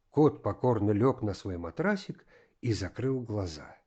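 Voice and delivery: plain reading voice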